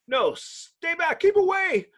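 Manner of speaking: high, loud cry to keep them away, slowing at first; high urgent shout of a boy on fire; desperate warning shout. Acted. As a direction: yell a frantic warning to the pilots. Torch warns off attacking planes.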